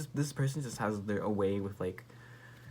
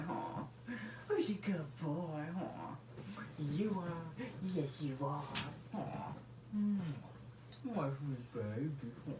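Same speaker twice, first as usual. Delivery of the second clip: high, soft cooing pet talk; 5 semitones higher, 7 dB quieter than usual. Real, not acted. Praising her dog Zeus.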